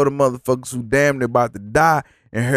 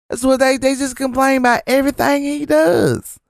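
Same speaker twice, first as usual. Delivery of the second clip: high, defensive imitation of a Trump fan; high, mocking impression of the lady defending Trump; gushing fan lady; 10 semitones higher than usual. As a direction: stick up for him in a high, mocking voice. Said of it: "And so she say".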